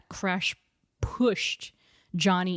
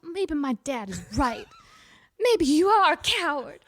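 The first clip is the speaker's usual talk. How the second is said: mocking girlfriend voice; 6 semitones higher, 3 dB louder than usual. Girl mocks boyfriend as coward.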